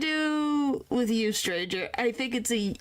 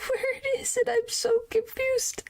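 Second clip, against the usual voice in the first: high, laughing squeak; giggly confusion; 13 semitones higher than usual. Real, not acted.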